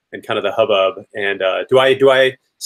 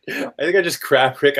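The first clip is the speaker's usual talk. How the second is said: normal talking voice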